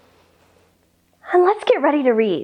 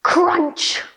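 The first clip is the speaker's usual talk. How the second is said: crunch sound; 4 semitones higher than usual. Shown as a demonstration. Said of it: "the giraffe takes a big bite".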